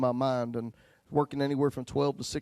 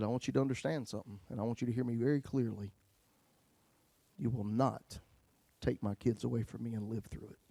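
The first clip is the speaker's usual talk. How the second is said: quiet cold threat; 3 semitones lower, 7 dB quieter than usual. Retold or imitated. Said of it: "I was in hatred".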